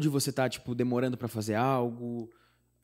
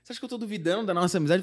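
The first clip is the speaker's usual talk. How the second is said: exasperated friend; 8 semitones higher, 3 dB louder, faster than usual. Retold or imitated. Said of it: "Que que você tá falando?".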